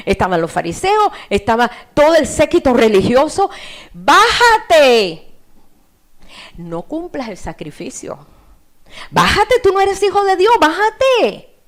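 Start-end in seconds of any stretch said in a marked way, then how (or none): 3.9-11.5 loud, high-pitched shouted taunt; jeering crowd shouts. Acted. Religious crowd yelling at Jesus on the cross, jeering shouts. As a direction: shout it up at him as a mocking crowd. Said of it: "Comenzaron a gritarle".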